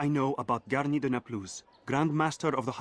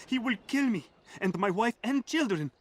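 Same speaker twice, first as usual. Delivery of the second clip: much higher, frantic cries for help; panicked plea; 10 semitones higher than usual. Acted. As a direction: sound hunted and desperate. Informant begs, pursued by knight.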